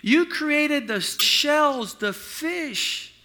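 loud, high, slow fervent prayer; old lady's prayer. Retold or imitated. Old Pentecostal lady praying loudly for the lost ring. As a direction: pray it out like a fervent church lady. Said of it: "and she says".

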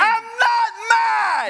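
high, slow loud yell denying anger; ironic shout. Retold or imitated. Coach insisting to kids he isn't mad, shouting. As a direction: yell that you're calm. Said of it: "Why are you screaming?".

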